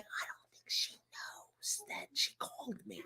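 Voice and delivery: whispered aside so grandma can't hear. Real, not acted. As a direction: hush it under your breath.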